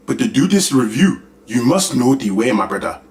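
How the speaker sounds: put-on meme African accent, "this" and "must" rising; mock African accent. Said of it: "I might as well join in too".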